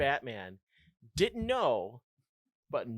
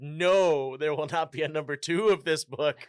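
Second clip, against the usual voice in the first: booming God voice; 9 dB louder than usual. Pretend. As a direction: thunder it like God forbidding a sequel.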